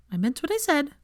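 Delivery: high, pointed reply; firm Hermione. Acted. Hermione answering Neville, high pointed firmness. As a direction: shut the question down.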